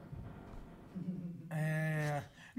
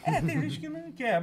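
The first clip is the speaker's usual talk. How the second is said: laughing; 9 semitones higher, 3 dB louder than usual. Real, not acted. Laughs at the spoiler joke.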